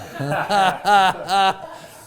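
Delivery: loud, high burst of laughter. Real, not acted. Guest cracking up at a tummy rumble, loud laughing. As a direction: crack up helplessly, pitched high.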